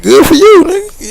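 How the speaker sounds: high, mocking sarcasm; dismissive mock retort, high; sarcastic inner voice. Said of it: "just thinking in my head".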